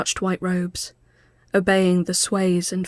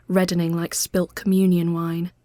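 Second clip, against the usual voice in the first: usual speaking voice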